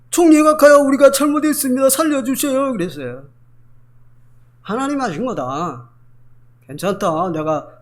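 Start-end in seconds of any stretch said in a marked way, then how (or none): 0.1-2.7 high, loud begging; pleading brothers. Acted. Brothers begging Joseph for their lives, loud and high. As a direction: beg for mercy at full voice.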